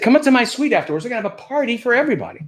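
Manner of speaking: high, fast, eager invitation; lightly voiced lady star, chattering quickly; Ginger Rogers. Star inviting the company to a party, high and quick. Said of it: "she said".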